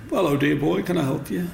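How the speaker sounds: posh shopkeeper. Retold or imitated. Shop owner greets young carver.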